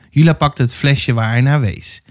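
usual speaking voice

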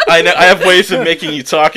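mock villain threat. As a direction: play the gloating interrogator for laughs. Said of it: "He's like".

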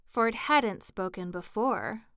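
ordinary delivery

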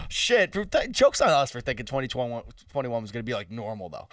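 usual speaking voice

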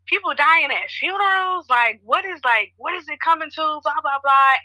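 high-pitched, incredulous questioning; retold freak-out. Friend retelling a group chat reaction, freaking out. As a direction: act out your own alarmed reaction, pitched well up. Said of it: "freaking out".

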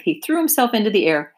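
plain reading voice

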